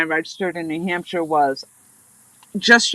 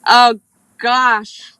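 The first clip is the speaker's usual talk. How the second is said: surprised; 6 semitones higher, 7 dB louder than usual. Real, not acted. Reacts to chat about guitar.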